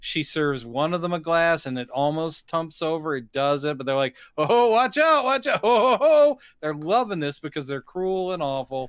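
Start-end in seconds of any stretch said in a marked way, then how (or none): 4.4-6.4 high, loud taunts with ho-ho laughs; mock jeering. Cruel customers heckling the server as she nearly spills, loud mock jeering. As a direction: whoop it up as if heckling a clumsy waiter.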